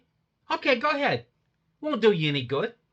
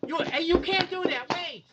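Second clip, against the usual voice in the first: panicked protest; 8 semitones higher, 3 dB quieter than usual. Acted. Suspect protests being booked.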